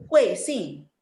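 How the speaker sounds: "Kuing Sing" loud, each syllable falling steeply; angry falling fourth tone. Shown as a demonstration. Teacher models it for a pupil, "Kuing Sing" falling angrily. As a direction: drop both words of "Kuing Sing" as if cross. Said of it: "seperti orang marah".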